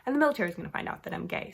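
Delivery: rattling it off in a nervous rush; panicked rushed quote. Retold or imitated. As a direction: blurt the fear out fast, one breath. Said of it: "So he's like".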